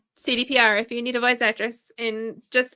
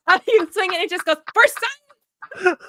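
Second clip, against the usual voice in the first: laughing; 12 semitones higher, 6 dB louder than usual. Pretend. Voicing a talking dildo weapon.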